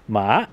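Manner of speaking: "Ma." sliding upward from low; rising tone. Shown as a demonstration. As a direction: lift "Ma." up like a question. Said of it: "the rising tone ... like you're asking a question".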